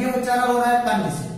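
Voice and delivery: ordinary talk, nothing marked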